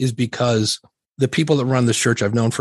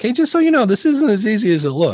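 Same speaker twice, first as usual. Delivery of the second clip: light higher quote; 9 semitones higher, 3 dB louder than usual. Retold or imitated. Quoting his own message, higher.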